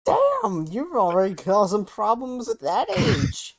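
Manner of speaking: high, teasing amazement; mock impressed. Real, not acted. Friend teasing him about childhood mischief, high mock amazement.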